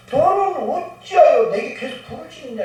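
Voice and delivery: lightly voiced divine scolding; mild rebuke. God lightly rebuking Moses, a mild reproachful question. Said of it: "책망 비슷한 얘기를 합니다".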